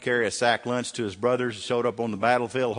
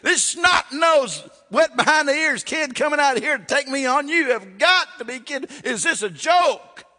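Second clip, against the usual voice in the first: high, loud mocking taunt; Goliath scoffing at young David, loud and high; scornful giant; 6 dB louder than usual. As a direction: sneer like a giant laughing at a boy. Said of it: "He's going".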